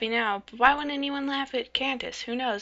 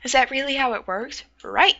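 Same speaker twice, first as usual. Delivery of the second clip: ordinary talk, nothing marked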